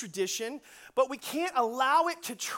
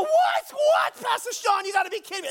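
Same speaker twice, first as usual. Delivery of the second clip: very high, loud screech of disbelief; shouted, high-pitched mock outrage; freaked-out yelling; 12 semitones higher, 8 dB louder than usual. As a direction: imitate horrified teens shrieking at their pastor. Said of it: "they're freaking out, they're yelling at him".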